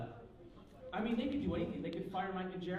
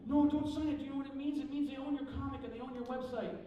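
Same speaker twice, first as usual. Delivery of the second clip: high, loud, hurried-to-convince warning; urgent warning; 8 semitones higher, 5 dB louder than usual. Retold or imitated. Cartoonist warns friends about a contract, urgent. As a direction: plead with a friend not to do it.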